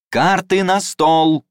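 loud, slow, high shout; shouting over everyone. Acted. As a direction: call it out over the crowd. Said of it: "перекрикивая всех, объявил".

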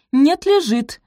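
high, loud insistence; insistent contradiction. A narrator's reading. Posy contradicting her mother, loudly insisting. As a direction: stand your ground stubbornly. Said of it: "настояла на своём Пози".

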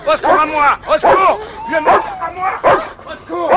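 very high, very loud cries for help; terrified screaming. Acted. Man attacked by a monster, terrified screaming. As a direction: scream for your life.

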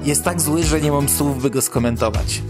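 usual speaking voice

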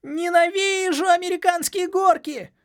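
high, slow squeaky yell. Acted. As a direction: shriek it while being tossed about. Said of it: "крутился внутри и орал".

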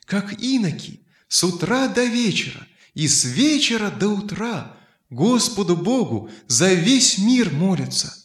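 ordinary delivery, nothing marked

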